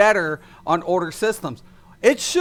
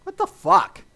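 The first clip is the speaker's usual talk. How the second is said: incredulous; 11 semitones higher, 3 dB louder than usual. Real, not acted. Exasperated rant.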